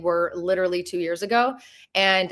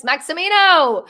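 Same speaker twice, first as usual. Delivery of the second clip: excited; 8 semitones higher, 8 dB louder, slower than usual. Real, not acted. Greeting a viewer by name.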